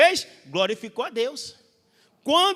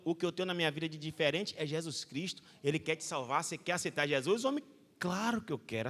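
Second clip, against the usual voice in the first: conversa suave; 7 semitones lower, 10 dB quieter than usual. Retold or imitated.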